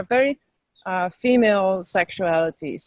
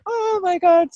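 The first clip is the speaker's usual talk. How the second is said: excited mock exclamation; 12 semitones higher, 4 dB louder than usual. Telling the host about downloads, acted excited amazement. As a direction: act out being amazed, pitched way up. Acting out her reaction to downloads.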